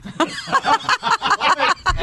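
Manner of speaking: squealing laugh; high laugh burst. Real, not acted. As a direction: break up laughing. Big laugh, far high.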